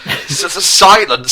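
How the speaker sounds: a beaten prince choking on fake tears; mock crying. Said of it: "with crying".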